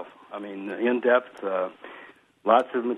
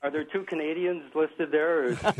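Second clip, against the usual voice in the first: laughing; 7 semitones higher than usual. Real, not acted. Caller joking about accents.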